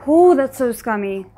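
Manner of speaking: normal talking voice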